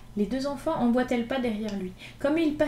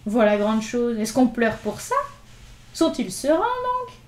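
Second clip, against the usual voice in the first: louder, mocking street-boy swagger; scoffing; 6 dB louder than usual. Gavroche teasing the crying boys, loud and mocking. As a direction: brush off their tears with bravado.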